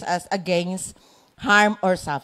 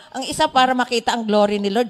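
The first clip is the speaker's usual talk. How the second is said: ordinary delivery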